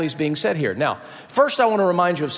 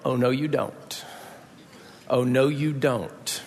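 low, hushed warning, slower on the repeat; Sarah's warning refusal; 5 semitones lower, 6 dB quieter, slower than usual. Acted. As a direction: drop low and soft to forbid it. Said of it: "Sarah's going".

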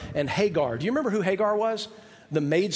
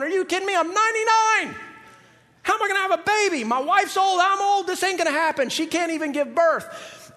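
higher, mock disbelieving old man; incredulous Abraham; 11 semitones higher than usual. Preacher voicing old Abraham doubting God's promise, high incredulous. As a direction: play him scoffing at the promise. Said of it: "starts laughing his head off going".

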